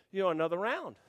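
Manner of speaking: ordinary talk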